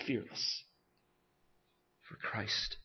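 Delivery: breathy whisper of resolve. Acted. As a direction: whisper it like a vow. Whispered climax.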